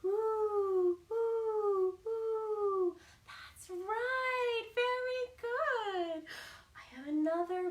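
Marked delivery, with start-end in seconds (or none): 0.0-3.0 louder hooting owl; owl hoots. Retold or imitated. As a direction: hoot like an owl. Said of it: "What does an owl say?".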